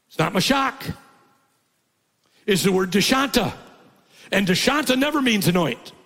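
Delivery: high, loud preaching voice; full-force preaching. Acted. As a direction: press the point on the congregation.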